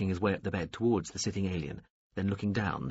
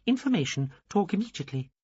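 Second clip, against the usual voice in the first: high alien voice; 10 semitones higher than usual. A narrator's reading. Alien insists on talking.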